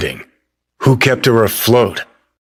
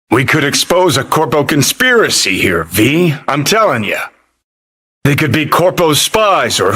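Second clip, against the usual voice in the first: higher-pitched, keyed-up urging; urgent excitement; 5 semitones higher than usual. Acted. Urging V to expose a corpo conspiracy, keyed-up. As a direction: push your partner on, voice raised in pitch.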